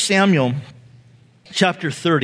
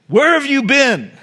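mom demanding; 6 semitones higher, 4 dB louder than usual. Retold or imitated. Mom confronts late teenager.

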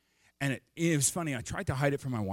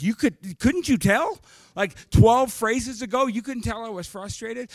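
exasperated retort; 9 semitones higher, 8 dB louder than usual. Retold or imitated. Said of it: "I'm like".

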